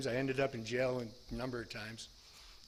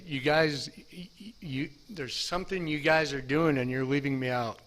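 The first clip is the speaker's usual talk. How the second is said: louder, stammering call-out; confronting coworkers; 7 dB louder than usual. Retold or imitated. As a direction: catch them in the act and demand in.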